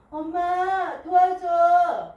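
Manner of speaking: loud, high, drawn-out cry for help; calling out. A narrator's reading. Mother calls her own mother for help, calling out. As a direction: call to Mom with long stretched words. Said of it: "엄마의 엄마를 불렀어요".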